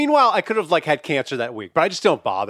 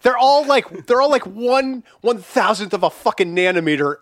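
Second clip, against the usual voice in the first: exasperated outburst; 4 semitones higher than usual. Real, not acted. Host correcting guest about Allen wrench sizes, exasperated outburst. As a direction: burst out in comic exasperation.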